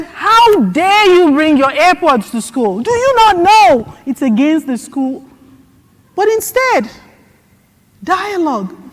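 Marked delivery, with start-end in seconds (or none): 0.0-5.2 loud, high mock scolding teacher; imagined teacher scolding a student over AirPods, mock angry. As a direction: play a teacher scolding a child.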